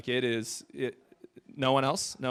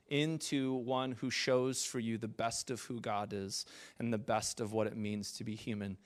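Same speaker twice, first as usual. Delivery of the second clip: usual speaking voice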